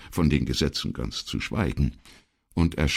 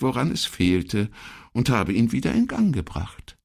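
higher, lively storytelling secretary; pleased secretary; 11 semitones higher, 3 dB louder than usual. Acted. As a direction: recount the little triumph in a high voice. Voices Elettra in dialogue.

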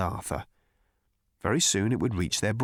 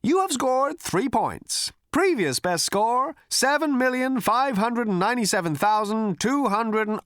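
a computer voice reading out a score; chirpy computer; 13 semitones higher, 4 dB louder than usual. A narrator's reading. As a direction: announce it like a cheerful computer.

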